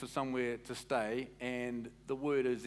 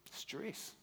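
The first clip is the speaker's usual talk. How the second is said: breathy whispered stress, much softer; 4 semitones higher, 11 dB quieter than usual. Acted. Preacher murmuring stress to congregation, whispered. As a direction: breathe the word out. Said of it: "repeated word stress".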